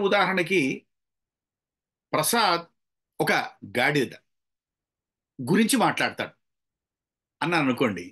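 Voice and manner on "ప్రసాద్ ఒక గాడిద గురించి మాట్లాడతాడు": long pause after గాడిద before గురించి; misplaced pauses. Shown as a demonstration. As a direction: break it up word by word, stopping hard after గాడిద. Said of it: "అనవసరంగా pause ఇచ్చి".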